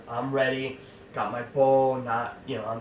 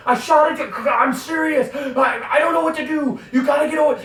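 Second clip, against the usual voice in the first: imitating a hysterical friend, much higher and shouted; panicked yelling down the phone, pitch way up; 10 dB louder than usual. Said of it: "he's hysterical".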